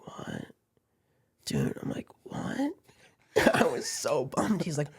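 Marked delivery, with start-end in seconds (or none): 0.1-0.5 hushed, disbelieving whisper; stunned whisper. Retold or imitated. Stunned reply to driver's refusal. 2.2-2.7 high-pitched, softer disbelief; incredulous question. Retold or imitated. Repeats stunned reply to driver.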